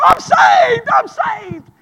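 loud, high, wailing cry; joyful wailing. Retold or imitated. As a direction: wail it out in the altar. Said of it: "they start wailing out".

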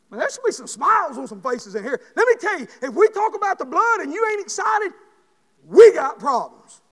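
high, very loud preaching, slowing on the last line; full-force preaching shout. Acted. Preacher whipping the congregation into a shout. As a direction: shout it out to the church like a revival.

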